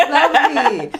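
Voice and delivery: a laugh, then lovely drawn out slowly. Real, not acted. Host delighted by the guest's degree, laughing slowly. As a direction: laugh with her and linger on the word. Host reacts to happy news.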